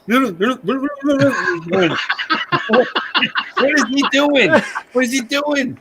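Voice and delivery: very high, baffled puppet voice; imitated high-pitched puppet question; Peanut. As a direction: squeak the question in mock bewilderment. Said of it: "He goes".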